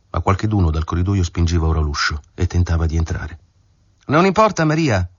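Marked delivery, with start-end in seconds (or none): 4.1-5.1 shout. A narrator's reading. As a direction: shout it at the door without turning. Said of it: "ella gridò senza voltarsi". (grido acuto verso la porta)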